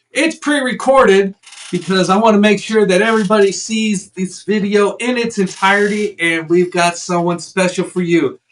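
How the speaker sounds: loud, high announcer welcome; big announcer voice welcoming viewers; show host intro. Acted. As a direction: open the show like a host hyping the crowd. Host pitch continues.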